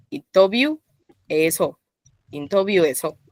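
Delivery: "Into view" lifted, then a break before "eso"; split three and two. Shown as a demonstration. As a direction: pause after "Into view", let "eso" drop. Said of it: "separo de tres en dos".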